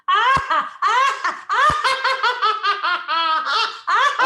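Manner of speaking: long, high, loud cackle; hysterical laugh. Shown as a demonstration. Coach demonstrates hysterical laughter to the class. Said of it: "And then laugh hysterically".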